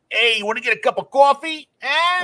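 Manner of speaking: high, hammed-up mock New York accent. Mocking a friend from Queens in a high, hammed-up New York accent. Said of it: "So, so Jace is from, the Queens".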